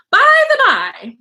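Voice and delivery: high, loud, drawn-out singling-out of each word; loud, high cat character voice; breezy storybook cat. A narrator's reading. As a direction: pipe up suddenly, high and slow. Cat suddenly back, calls out.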